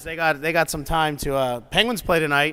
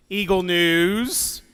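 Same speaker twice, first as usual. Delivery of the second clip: normal talking voice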